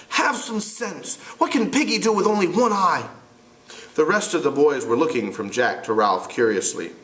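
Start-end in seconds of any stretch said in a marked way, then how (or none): 0.1-3.1 put-on higher, frustrated boy leader. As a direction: argue as an exasperated boy. Ralph voiced.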